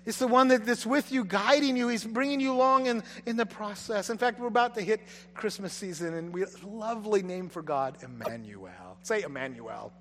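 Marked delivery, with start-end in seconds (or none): none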